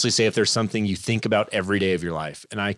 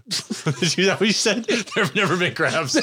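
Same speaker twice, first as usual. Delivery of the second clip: higher-pitched, through real laughter; laughing denial; 8 semitones higher than usual. Real, not acted. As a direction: protest while cracking up. Teased about crabs, cracks up.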